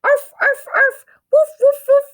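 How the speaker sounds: loud, high yappy barks; dog barking. Acted. Dog trying to talk on the phone, loud high barking. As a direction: bark like a little dog.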